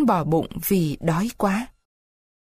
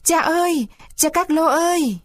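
crying out; 8 semitones higher than usual. Acted. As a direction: call out desperately for father. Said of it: "hét lên mấy tiếng".

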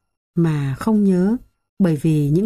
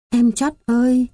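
put-on a boy's higher, tearful cry to his dead sister; sobbing cry; 6 semitones higher than usual. Boy sees sister's spirit in areca tree, sobbing call. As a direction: burst into sobs and call her name. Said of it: "Tôi òa lên nức nở".

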